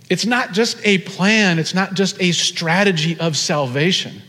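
normal talking voice

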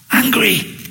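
high, short angry reply; a touch of angry. Acted. Elijah answering God, a hint of anger. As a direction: snap out the feeling. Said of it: "How are you feeling?".

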